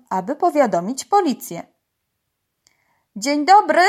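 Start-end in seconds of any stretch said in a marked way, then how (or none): 3.2-3.8 high, loud phone greeting; calling out. A narrator's reading. Uncle phones the police, calling out urgently. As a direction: hail them urgently, pitched up. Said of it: "zawołał po arabsku wuj".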